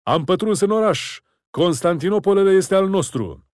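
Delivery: high, loud exultant cry; triumphant shout. A narrator's reading. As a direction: crow with victory. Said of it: "zbieră Daud".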